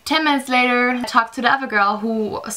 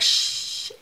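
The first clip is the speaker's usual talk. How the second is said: hushing whisper; 7 dB quieter than usual. Retold or imitated. Hushing herself before crying.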